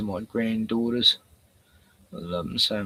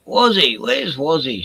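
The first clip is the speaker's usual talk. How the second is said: calling out; 3 semitones higher, 7 dB louder than usual. Real, not acted. Calling out for a viewer.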